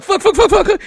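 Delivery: high, loud burst of panicked curses; panicked cursing. Retold or imitated. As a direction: spit them out in a rising panic. Panicking when car dies.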